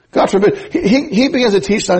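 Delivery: normal talking voice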